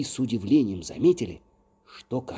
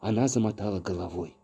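ordinary delivery, nothing marked

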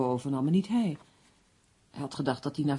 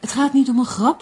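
higher and louder, cutting off the joke; urgent insistence; 7 semitones higher, 10 dB louder than usual. Acted. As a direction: press him hard to take it seriously. Insists the letters are real. (dringend en luider aandringen)

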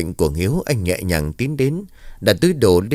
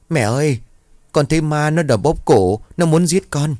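put-on high, frightened child's voice rising; a scared little boy clinging to his mother; whimpering child; 7 semitones higher than usual. Terrified son tells mother a ghost choked him, whimpering. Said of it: "mếu máo".

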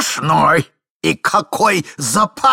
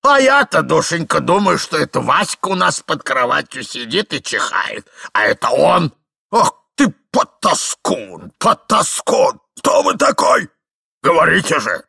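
put-on outraged old husband. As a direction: scold and demand answers, drawing out the insults. Старик-муж бранит незнакомца. (возмущённая брань старика)

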